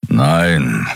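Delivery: short growled refusal. A narrator's reading. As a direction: growl it, a little below the narration. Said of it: "knurrte er".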